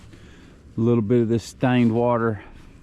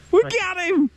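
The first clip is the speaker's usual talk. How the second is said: triumphant shout. Real, not acted. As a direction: cheer the landed fish. Fish finally landed.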